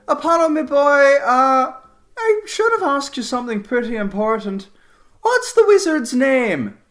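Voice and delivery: high, loud, hearty character voice; Cassidy, hearty urgency. Acted. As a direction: put an important question in a big high voice. Cassidy returns to ask Apollo.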